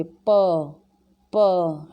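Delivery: प said slowly with a clear अ sound after the p; slow, careful प with the vowel heard. Shown as a demonstration. Teacher letting students hear the अ inside प, slow. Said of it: "अ का sound आएगा".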